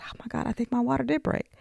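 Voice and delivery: soft, fast, stunned; mother realizing her water broke; hushed shock. Retold or imitated. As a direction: rush it out softly in shock. Retold realization.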